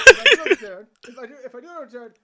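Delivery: high, loud hammed-up plea through laughter; mock heroic cry, laughing; laughing melodrama. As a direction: overact the farewell and crack up. Cleric's dramatic farewell, laughing.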